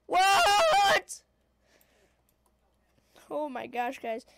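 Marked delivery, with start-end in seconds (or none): 0.1-1.2 loud high shocked shout. Real, not acted. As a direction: yell it in sudden shock. Sudden shock in game.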